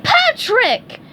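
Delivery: very high, loud scold. A narrator's reading. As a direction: snap his name in reproach. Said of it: "he scolded".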